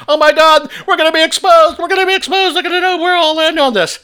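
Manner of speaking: high, shrieking mock panic. Host mocks panicking opponents with shrill fake fear. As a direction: parody their terror of being exposed. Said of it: "They're freaking out".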